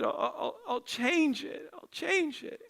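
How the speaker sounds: imitated soft, high, halting promise; tearful little boy giving in, acted; crying child. Crying son promises daddy he will change it, tearful. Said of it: "he was crying".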